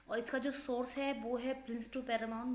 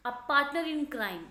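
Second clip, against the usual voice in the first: partner in crime said slowly, louder, higher; emphatic slow definition; 3 semitones higher, 6 dB louder, much slower than usual. Shown as a demonstration. Teacher stressing the definition, slow and loud. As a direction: lean on each word of the phrase. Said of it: "ऐसा इंसान जो आपका गलत कामों में साथ दे".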